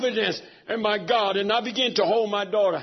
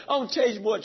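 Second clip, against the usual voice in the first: normal talking voice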